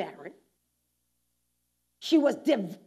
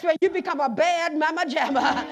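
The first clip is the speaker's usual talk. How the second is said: louder and louder preaching over the organ; full-force preaching; 5 semitones higher, 7 dB louder than usual. Acted. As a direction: preach it, building the fire. Preaching climax over music.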